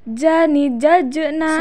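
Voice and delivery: "jajna" with a bounced j; slow "jajna", letting the closed j rebound; bouncing stopped consonant. Shown as a demonstration. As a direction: release the middle j of "jajna" with a small echo. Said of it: "Ketika mati suaranya mantul".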